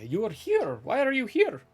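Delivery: high, loud, demanding; pointed question. Acted. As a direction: press them for an answer. Gatekeeper demands purpose.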